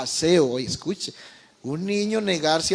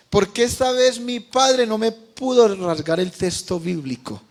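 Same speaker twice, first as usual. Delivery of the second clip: shouting for joy; 5 semitones higher, 5 dB louder than usual. Retold or imitated. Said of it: "vino saltando y gritando de felicidad".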